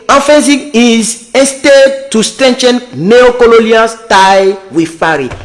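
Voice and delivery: slow, careful reading of English text; slow English read-aloud. Real, not acted. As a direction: read each English word out slowly. Reads English article aloud slowly.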